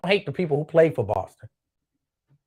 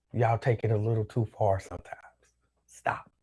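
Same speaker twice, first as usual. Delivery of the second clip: half-voiced whispered aside, much softer; 6 semitones lower, 6 dB quieter than usual. Real, not acted. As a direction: say it under your breath to the crowd. Muttered aside to the audience.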